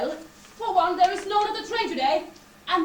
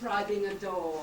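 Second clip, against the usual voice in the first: deadpan door; 11 semitones lower, 6 dB quieter than usual. Acted. Creature guard deadpan.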